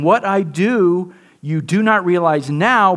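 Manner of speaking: slow, deliberate words of Jesus; Jesus, gently. Retold or imitated. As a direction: give it slowly, with patience. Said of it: "Jesus answered and said to him".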